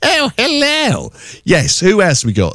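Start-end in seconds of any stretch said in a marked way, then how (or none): 0.0-1.1 high, slow, lilting posh hello; posh telephone voice. Retold or imitated. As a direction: put on a posh phone voice. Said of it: "but the posh telephone voice".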